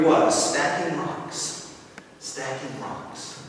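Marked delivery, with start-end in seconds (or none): 2.2-3.4 slow, much softer trailing repeat; fading quiet. A narrator's reading. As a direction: let the words fade away. Narration repeats phrase, fading.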